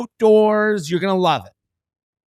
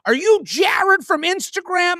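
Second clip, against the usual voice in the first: imitated older woman, much higher; slow, high-pitched mock fan question; 11 semitones higher than usual. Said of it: "she's an older woman".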